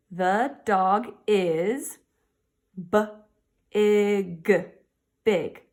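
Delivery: very slow, word by word; slow sounding out. Shown as a demonstration. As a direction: stretch each word like decoding. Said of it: "teacher sounds out the sentence".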